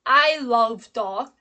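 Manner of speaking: "I" long and loud at the front; stress on I. Shown as a demonstration. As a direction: punch "I", then ease off. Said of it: "I is the most important information".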